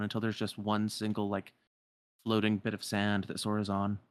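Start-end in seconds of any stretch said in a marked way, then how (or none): none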